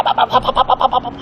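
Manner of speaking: high staccato burst of mock gunfire; imitated machine-gun fire.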